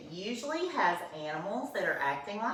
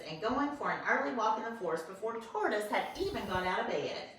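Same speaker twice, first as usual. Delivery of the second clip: ordinary delivery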